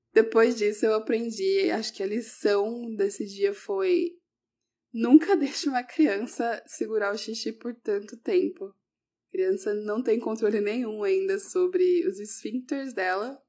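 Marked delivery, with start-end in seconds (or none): none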